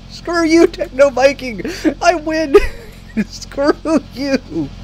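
high, gleeful victory shout through laughs; exultant high-pitched taunting; gloating triumph. Real, not acted. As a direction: crow it at a beaten rival, laughing. Gloating at beaten game rival.

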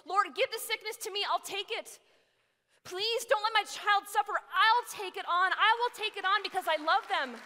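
loud, high, impassioned plea to God; pleading parent. Acted. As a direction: cry out to the Lord for your child. Acted desperate parent's plea to God.

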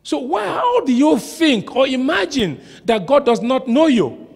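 normal talking voice

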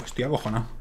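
quiet admission. Real, not acted. Scared after the fire.

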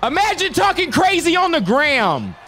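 loud, high trash-talk yell; taunting shout. Real, not acted. Gamer trash-talking online haters with loud taunting.

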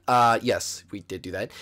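normal talking voice

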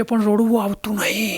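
whispered, hushed excuse. Retold or imitated. Widower whispering that he cannot cry. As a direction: breathe it out without voice.

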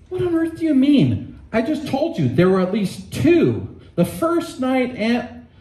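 put-on high, protesting boy voice; indignant boy. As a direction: Shasta objecting, pitched well up. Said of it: "said Shasta".

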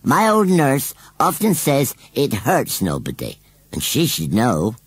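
high, loud, slow rustic voice; sulky scarecrow. A narrator's reading. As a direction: grumble stubbornly as a country scarecrow. Scarecrow defends his sulking.

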